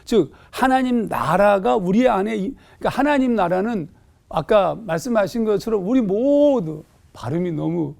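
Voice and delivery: ordinary talk, nothing marked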